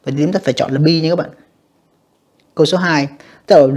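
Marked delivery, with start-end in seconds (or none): none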